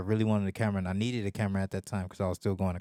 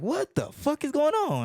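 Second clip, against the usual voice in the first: very high incredulous outburst; retold exasperation; 3 dB louder than usual. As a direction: act out total bafflement. Retold alarm at rising bill.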